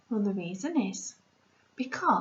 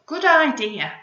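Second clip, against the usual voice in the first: louder, keen agreement; eager; 4 semitones higher, 9 dB louder than usual. Acted. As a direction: agree with delight. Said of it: "said Spike".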